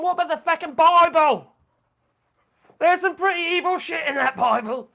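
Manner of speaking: loud, high-pitched outburst; indignant retort. Real, not acted. Streamer throws the Bible back at critics, indignant.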